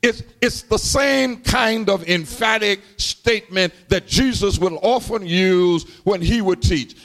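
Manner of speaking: ordinary talk, nothing marked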